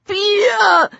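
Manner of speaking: high cry through a blocked nose; nasal, stuffy. A narrator's reading. Lang gasps at Peter through a broken nose, nasal. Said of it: "Lang's voice was nasal, stuffy".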